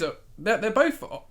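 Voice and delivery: ordinary delivery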